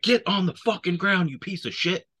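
high, barked order at gunpoint; imitating a sergeant yelling get down; angry command. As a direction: bark it like a furious sergeant. Soldier retold confronting Afghan officer.

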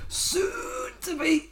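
very high, slow, strained neck-tight squeeze; Zack Gibson impression. Reviewer mocking Gibson's promo line, strained high voice. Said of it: "the tense neck voice with it".

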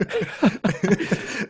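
a real high burst of laughter. Real, not acted. Host cracking up at the guest's joke, high laugh.

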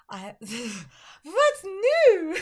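high, slow, laughing diva greeting; laughing imitation. Imitating the singer for co-host, high and laughing. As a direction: stretch it out, giggling.

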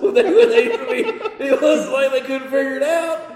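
laughing through the words; cracking up mid-sentence. Real, not acted. Laughing while telling.

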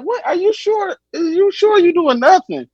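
put-on high, knowing old-lady voice; grandma calmly doubting, high voice; cool grandma voice. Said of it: "she in this bitch playing it so cool".

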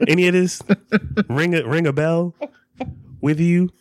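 teasing through laughter; laughing. Real, not acted. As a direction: giggle while you tease. Teasing partner while laughing.

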